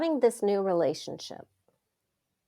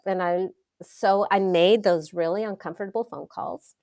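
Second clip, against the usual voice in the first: ordinary talk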